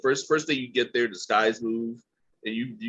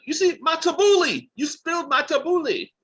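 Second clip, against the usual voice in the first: high, loud, hammed-up outrage; mock outraged cry; 6 dB louder than usual. Podcaster playing a diner whose food was spilled, mock outraged cry. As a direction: cry out in exaggerated dismay, pitched way up.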